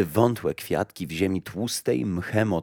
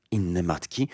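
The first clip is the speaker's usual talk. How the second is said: hushed, softer startled question; mildly frightened whisper; 5 dB quieter than usual. A narrator's reading. Mother recoils at harming other mothers, hushed and mildly afraid. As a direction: ask softly with a touch of fear. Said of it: "szepnęła z przestrachem".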